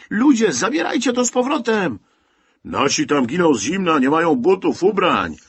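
loud, high, indignant crowd voice; outraged shout. A narrator's reading. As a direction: protest at the burning pile. Outraged crowd at burning clothes. (oburzony krzyk, głośno i wysoko)

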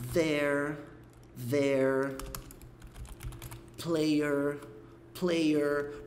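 "there" and "player" ending with lips pursed on the r; rounded lips. Shown as a demonstration. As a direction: pucker slightly on each final r. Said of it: "Por que que eu tô fazendo um biquinho?". (r final com biquinho)

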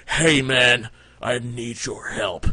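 a slow, calm stranger asking for help; hint of a big hulking man, drawn out; lightly voiced big dude. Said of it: "he was like".

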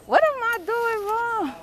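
high, drawn-out wail; exasperated wail. Real, not acted. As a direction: wail it slowly, stuck and struggling. Struggling and cannot get up.

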